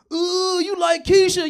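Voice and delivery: high, slow, drawn-out schoolyard taunt; sing-song it like a kid teasing a friend. Retold or imitated. Comic retelling a playground tease, high mocking kid voice.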